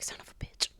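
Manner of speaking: breathy whisper under the breath; whispered son of a bitch; whispered curse. Retold or imitated. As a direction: mutter it to yourself in disbelief. Muttering shock under breath.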